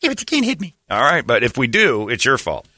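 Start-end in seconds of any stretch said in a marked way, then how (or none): 0.0-0.8 very high whiny falsetto; hammed-up squeaky girl; mock girly whine. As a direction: whine it again and again. Mimicking girl on freeway.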